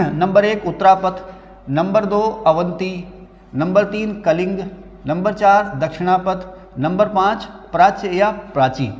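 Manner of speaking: slow, spaced numbering for notes; slow dictation. Shown as a demonstration. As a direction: read each item out slowly so students can write. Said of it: "dictating list slowly".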